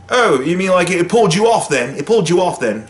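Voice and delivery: put-on doing a rock monster in a New Zealand accent; playful New Zealand accent impression. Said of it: "That's my New Zealand accent".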